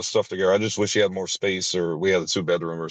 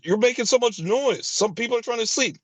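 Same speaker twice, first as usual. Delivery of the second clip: much higher, whiny scolding voice; mocking the landlady. Landlady scolding him for moving noise, in a high whiny nag. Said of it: "And she says".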